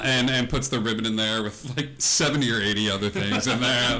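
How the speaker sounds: normal talking voice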